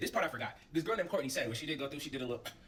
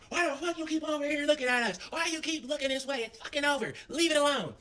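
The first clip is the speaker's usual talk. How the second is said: mock yelling girl; 10 semitones higher than usual. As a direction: imitate the girl yelling at them. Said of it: "girls start yelling again".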